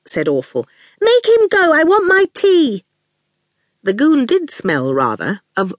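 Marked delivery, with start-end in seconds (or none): 1.0-2.8 put-on high, insistent little-girl voice; demanding child. A narrator's reading. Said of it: "in the piercing way that had earned her name".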